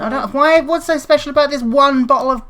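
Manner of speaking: high, loud frustrated complaint; worked-up, loud demand; exasperated ranting. Real, not acted. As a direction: rant as if at your wits' end. Cannot find the item.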